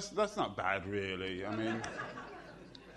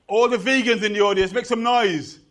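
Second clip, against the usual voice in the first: louder and faster, rousing the crowd; louder call-out; 7 semitones higher, 11 dB louder than usual. Shown as a demonstration. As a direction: pump them up to cheer. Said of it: "you can do better than that".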